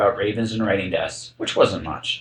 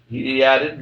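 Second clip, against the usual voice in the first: ordinary delivery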